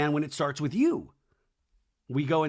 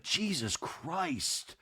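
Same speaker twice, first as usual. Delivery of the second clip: drawn-out, hushed imitation of disbelief; mock exasperation; 9 dB quieter, much slower than usual. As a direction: stretch it out slowly and softly. Friend reacts to the story.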